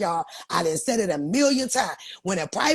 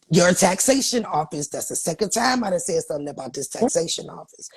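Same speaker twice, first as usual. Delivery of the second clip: normal talking voice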